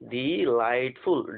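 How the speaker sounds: slow, clear model pronunciation; slow model word. Shown as a demonstration. Teacher modelling the word for students, slowly. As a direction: say it slowly for them to repeat. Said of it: "क्या पढ़ेंगे?".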